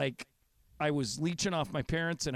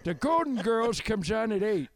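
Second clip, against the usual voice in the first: lightly voiced old man; old guy voice; 6 semitones higher than usual. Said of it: "do your old guy voice".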